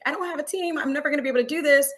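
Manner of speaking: high-pitched mock despair; imitating your own despairing wail, no real tears; retold tearful despair. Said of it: "And I remember crying, like".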